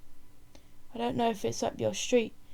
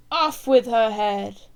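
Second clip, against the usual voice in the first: slow, much louder command; shouted order; 9 dB louder, slower than usual. Acted. Ruler ordering the woman's execution, a slow loud shout.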